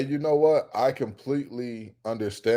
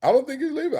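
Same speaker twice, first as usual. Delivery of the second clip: "I don't think he's leaving" pitched way up; higher insistence; 14 semitones higher, much faster than usual. Real, not acted. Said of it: "repeated higher".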